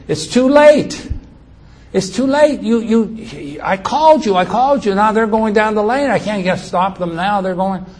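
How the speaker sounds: high, strained urgency, easing slightly toward the end; urgent shouting back. Retold or imitated. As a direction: call back helplessly, pitched well above normal.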